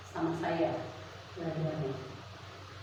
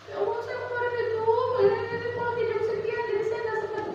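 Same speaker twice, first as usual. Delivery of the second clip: flustered parent excuses; 9 semitones higher than usual. Retold or imitated.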